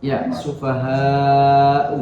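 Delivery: sufaha'u with the vowel before the hamzah held very long; long madd. Shown as a demonstration. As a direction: drag out sufaha'u slowly. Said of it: "mat wajib".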